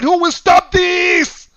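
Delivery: high, slow, anguished shout; crying out. Retold or imitated. Preacher voicing a wronged man's slow anguished cry. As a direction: cry it out like a desperate victim. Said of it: "one cried out and said".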